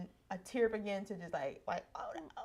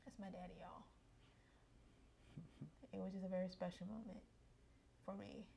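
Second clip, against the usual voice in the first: much softer, tearful words about dad; choked up; 15 dB quieter than usual. Real, not acted. Daughter telling viewers about her late father, softly tearful. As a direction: let the voice go quiet with tears. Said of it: "emotional about dad".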